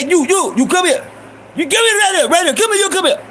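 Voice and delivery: high, insistent storekeeper ordering someone over; angry Iranian shopkeeper. Retold or imitated. Shopkeeper calls a boy caught stealing, imitated high and angry. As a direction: summon a young thief in a high, urgent voice. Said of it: "it was the Iranian motherfucker".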